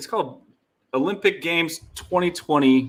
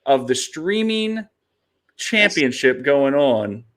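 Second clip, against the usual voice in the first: slow, drawn-out, high quiz question; dramatic quiz host; 4 semitones higher, slower than usual. Retold or imitated. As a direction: read it like a game-show host. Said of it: "the question that buried you was".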